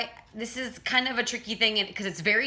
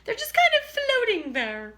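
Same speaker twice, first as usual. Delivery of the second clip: much higher, floaty voice; floating head voice; 13 semitones higher than usual. Shown as a demonstration. Coach shows floating thin cords, high. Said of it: "the vocal cords are thin".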